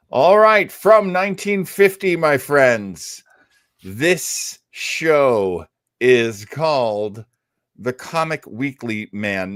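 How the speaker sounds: big, slow showman announcement; announcer intro. Acted. Host presenting an old radio show to listeners, hammed-up announcer voice.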